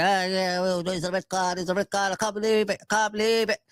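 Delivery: lightly voiced excitable pundit, rising as he goes; mimicking a worked-up Gary Neville calling a red card. Streamer mimics pundit Gary Neville getting worked up, mild mock excitement. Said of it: "doing the Gary Neville voice".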